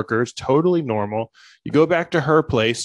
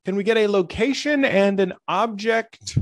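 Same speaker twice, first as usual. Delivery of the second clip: higher, slow, chirpy host patter; cheesy improv host; 7 semitones higher, slower than usual. Pretend. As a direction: play the eager showman warming up a crowd. Villain hosting improv show.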